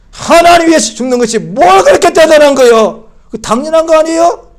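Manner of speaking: loud, high challenge with 그렇게 stressed; forceful preaching. Acted. Preacher challenging believers on dying for God, loud and high. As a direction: thunder the question at the congregation.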